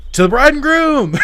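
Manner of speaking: loud, high, drawn-out yell; big re-enacted wedding-toast holler. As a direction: shout the toast at the top of your lungs. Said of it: "So I just yelled".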